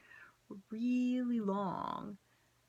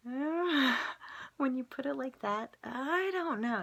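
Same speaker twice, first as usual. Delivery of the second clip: higher and louder, an awkward group answer; mock sheepish reply; 7 semitones higher, 5 dB louder, slower than usual. Group replying to the psychic, sheepish and loud. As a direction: play it like a caught-out crowd going uh.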